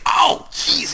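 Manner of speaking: high-pitched mock yelp of pain. Interviewer hit on the shins, high mock yelp. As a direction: yelp like you just got whacked on the shins.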